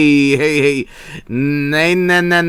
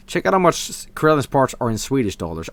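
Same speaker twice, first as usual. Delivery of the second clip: ordinary talk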